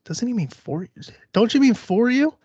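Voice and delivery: high, rising woman's voice; scared woman. Acted. Frightened woman interrupting the killer from the closet, high-pitched. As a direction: pipe up high with a timid correction. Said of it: "like, scared".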